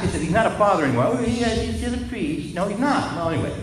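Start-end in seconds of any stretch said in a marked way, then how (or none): 1.1-2.5 higher, lightly voiced old nun; a hint of the elderly sister insisting; mild mock protest. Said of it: "She said".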